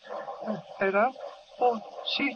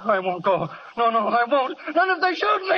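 rising, much higher and louder; panicked refusal; 5 semitones higher, 4 dB louder than usual. Acted. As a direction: panic building to a scream.

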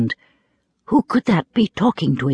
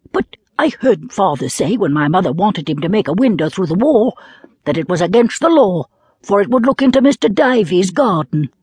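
put-on higher, louder child protesting; a hint of an earnest little boy; 5 semitones higher, 3 dB louder than usual. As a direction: Diamond protests to the wind, a touch higher. Diamond voiced as child.

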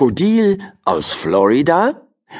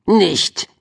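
high, curt penguin voice; a cheeky one-word put-down; dry penguin; 8 semitones higher than usual. A narrator's reading. Penguin Juri mocking the swim cap wish, high and curt. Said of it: "sagte der Pinguin Juri".

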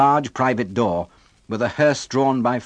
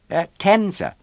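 put-on timid small boy; 9 semitones higher than usual. A narrator's reading. Oliver timidly gives his age.

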